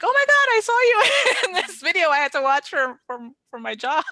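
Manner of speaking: high, loud imitation of a thrilled friend; excited friend. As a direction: gush like a friend who just spotted you. Friends' excited messages acted out.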